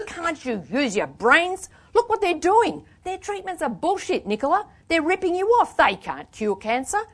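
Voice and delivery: high, exasperated outburst; rage. A narrator's reading. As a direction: plead furiously for some sense. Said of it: "Where was this rage stored in me?".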